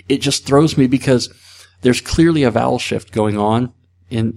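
ordinary talk, nothing marked

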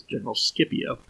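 General Scipio said as Skipio, hard K, whispered; Skipio pronunciation. Retold or imitated. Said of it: "that's how he pronounced them".

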